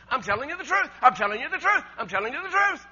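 loud, high, hammered repetition; emphatic. Acted. Preacher voicing Jesus insisting to his hearers, loud emphatic cry. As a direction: pound out each repeat with rising force. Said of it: "So emphatic, he says".

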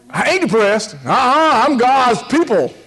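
high, loud mock denial of depression; mocking churchgoer. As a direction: send up the believer who won't admit sadness. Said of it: "we get like that".

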